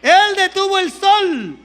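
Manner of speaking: loud, slow, pounding preacher's declarations; full-force preaching. Acted. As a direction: hammer each line home to the congregation.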